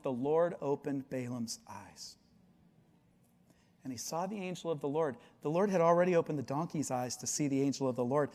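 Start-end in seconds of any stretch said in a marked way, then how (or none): none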